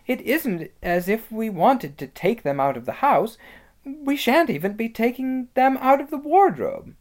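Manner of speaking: put-on high girlish voice making a case; high-pitched, reasoning girl; persuasive Susan. Susan reasoning about coats.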